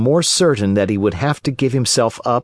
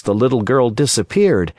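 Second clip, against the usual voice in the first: plain reading voice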